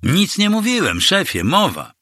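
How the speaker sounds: very high-pitched voice of denial; high, protesting henchman voice; hasty denial. A narrator's reading. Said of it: "zaparł się ochroniarz".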